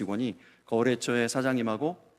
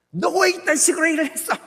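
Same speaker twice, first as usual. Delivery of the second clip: much higher, loud angry boss rebuke; imagined boss yelling; mock scolding; 7 dB louder than usual. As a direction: tear into the employee like a furious manager. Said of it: "막 이렇게 나올 줄 알았는데".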